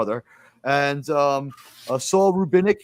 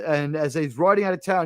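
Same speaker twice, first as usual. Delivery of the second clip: ordinary talk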